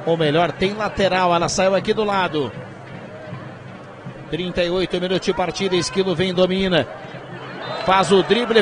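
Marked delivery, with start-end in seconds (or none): none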